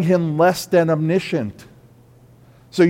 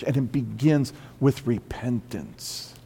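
ordinary talk, nothing marked

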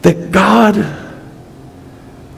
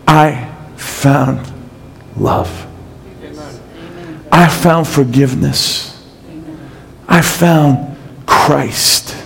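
tender testimony; 7 semitones lower, 7 dB quieter, slower than usual. Real, not acted.

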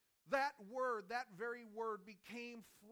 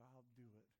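quiet resolve; 8 semitones lower, 14 dB quieter than usual. Retold or imitated. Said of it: "the Son said to the Father".

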